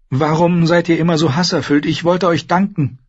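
put-on a young lady's hurt protest; upset Sansa. Sansa voiced by narrator.

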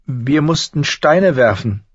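put-on high girl's voice, slow and downcast; dejected confession. A narrator's reading. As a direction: daughter admitting a shameful deed. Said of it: "gestand sie bedrückt". (bedrückt gestanden)

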